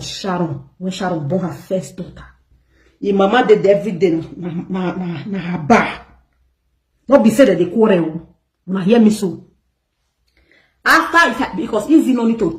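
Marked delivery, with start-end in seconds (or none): none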